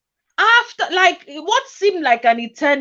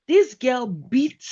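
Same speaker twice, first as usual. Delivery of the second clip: ordinary talk, nothing marked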